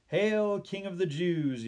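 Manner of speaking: high, loud mocking proclamation; soldier's yell. A narrator's reading. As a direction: yell it out over the crowd. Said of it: "yelled a soldier".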